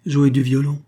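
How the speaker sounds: loud, high incredulous echo; repeated in disbelief. A narrator's reading. Mein echoing the stranger's promise, loud and incredulous. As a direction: throw the phrase back, disbelieving. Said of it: "répéta Joseph Mein".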